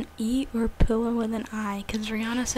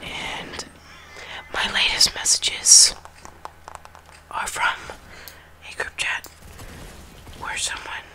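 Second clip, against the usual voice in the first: murmured phone tour; whispering. Real, not acted.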